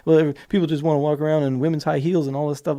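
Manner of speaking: mild sneering caricature of a naysayer; mocking skeptic. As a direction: lightly mimic the dismissive objector. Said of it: "don't start coloring this with, like".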